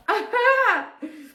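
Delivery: high, breathy laugh through tears; teary laugh. Real, not acted.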